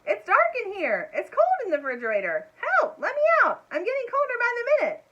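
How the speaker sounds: high, loud, frantic calls; frantic shouting. A narrator's reading. Trapped mouse yelling from inside the fridge, frantic. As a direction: shout to be heard through the door.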